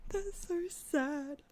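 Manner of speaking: high, drawn-out self-mocking whine; embarrassed whine. Real, not acted. Streamer embarrassed by their own meow, high slow whine.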